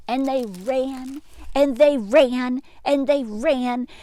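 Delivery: rising excitement in the chase; building excitement. Acted. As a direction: pound out each they ran.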